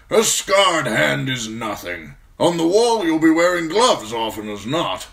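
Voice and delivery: put-on loud, high-pitched old commander brushing it off; gruff old commander, dismissive. Old commander to his injured young steward, loud and dismissive. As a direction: project like a veteran officer waving away a worry.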